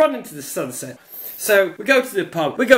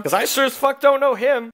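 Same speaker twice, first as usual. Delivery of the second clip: ordinary talk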